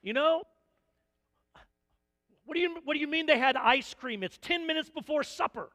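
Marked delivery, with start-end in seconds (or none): 2.4-5.7 higher, louder and fast, half-mock outrage; indignant parent. Parent scolding the grandparent over ice cream before supper, in mock outrage. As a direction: protest like an offended parent.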